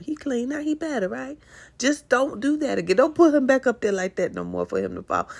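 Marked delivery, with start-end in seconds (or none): 0.0-4.3 much higher, sing-song mom voice; baby talk to toddler. Retold or imitated. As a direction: coo at a two-year-old. Mother talking to toddler.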